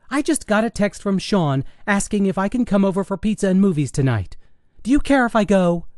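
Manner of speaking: high daughter's voice, asking permission; high-pitched, impatient daughter; pleading teenage daughter. Acted. Daughter asks mother's permission.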